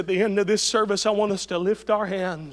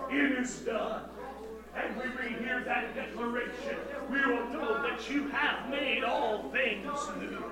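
softer, higher, earnest prayer voice; 5 semitones higher, 5 dB quieter than usual. Acted. Pastor leading the church in closing prayer, soft earnest longing. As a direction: pray it softly, longing.

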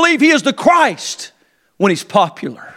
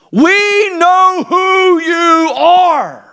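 stamp every word, slowly; emphatic confession, drawn out; 4 semitones higher, much slower than usual. Retold or imitated. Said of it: "very decisive, emphatic, and brief".

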